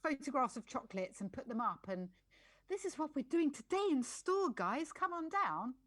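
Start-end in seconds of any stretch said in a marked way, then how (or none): 2.7-5.8 high, drawn-out shop announcement; mock a cheery social media sales post; chirpy store promo. Acting out a shop's social post.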